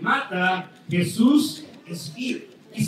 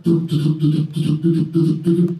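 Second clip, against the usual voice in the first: loud, lower clopping of hooves; galloping hooves; 6 semitones lower, 7 dB louder than usual. Retold or imitated. Storyteller imitates the horse galloping away, loud hoof sounds. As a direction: beat out the gallop with your voice.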